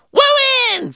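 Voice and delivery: "Wooined" very high with a w; squeaky toddler "Wooined"; says w for r. Retold or imitated. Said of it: "said Philip".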